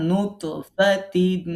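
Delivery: নুতফাতিন extremely slow, with a bounce on t; teacher drilling নুতফাতিন with a bounce; slow qalqala demo. Shown as a demonstration. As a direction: drag out নুতফাতিন and jolt the t. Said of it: "কলকলা করতে".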